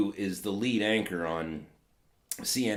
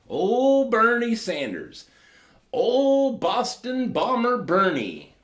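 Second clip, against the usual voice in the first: big, slow, much higher, louder hype call; mock announcer; 11 semitones higher, 8 dB louder, slower than usual. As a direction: boom out the nickname like a ring announcer.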